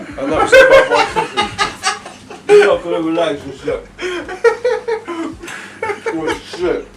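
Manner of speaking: high laughing voice. Real, not acted. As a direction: laugh through the words. Laughing confession.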